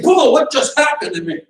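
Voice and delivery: high, dazed cry of confusion; reeling from the first punch; stunned. Retold or imitated. Instructor voices his stunned self after a jaw punch, high and dazed. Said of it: "I'm gonna be thinking".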